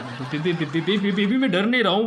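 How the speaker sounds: stuck repeatedly on the first syllable; nervous stammering denial. Acted. Husband denying lizard fear to wife, nervously stammering. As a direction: stutter while trying to sound brave.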